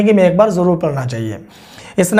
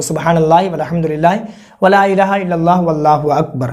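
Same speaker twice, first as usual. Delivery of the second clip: fast, words run together, as in actual prayer; quick run-through. Shown as a demonstration. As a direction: rattle the kalma off at the pace you'd count it. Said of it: "फिर से दस मरतबा पढ़ना है उसी कलमे को". (तेज़ी से, बिना रुके कलमा पढ़ना)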